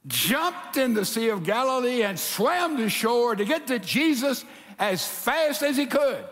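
ordinary delivery

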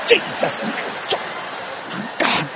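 soft, breathy mock agony; strained pained oath. Preacher hurting under the house swears by saints, strained. Pained oaths while working on pipe.

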